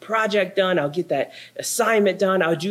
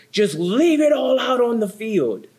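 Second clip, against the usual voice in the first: higher, a touch of a hyped coach; macho pep-talk quote; 6 semitones higher than usual. Retold or imitated. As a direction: mimic a locker-room slogan lightly. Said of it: "American macho inspirational quote".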